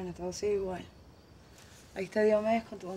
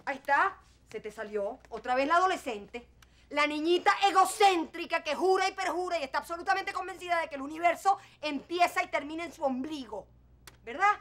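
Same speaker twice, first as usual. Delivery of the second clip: much louder and higher, biting anger; furious retort; 6 semitones higher, 6 dB louder than usual. Acted. Mother snaps back at her selfish daughter, loud and furious. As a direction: lash back at the spoiled child.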